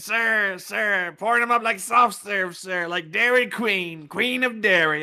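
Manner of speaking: high put-on voice, slow; slow, high caricature pleading "sir"; mock old man voice. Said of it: "we do the voices".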